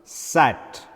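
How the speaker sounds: sat with an open a as in cat; demonstrated open a in "sat"; A sound in sat. Shown as a demonstration. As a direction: say sat carefully with an open vowel. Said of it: "So, the word is pronounced".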